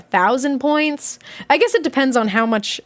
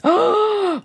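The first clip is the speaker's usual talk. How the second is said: surprised gasp; 9 semitones higher than usual. Real, not acted. Reacting to fifteen times payout.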